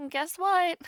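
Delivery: ordinary delivery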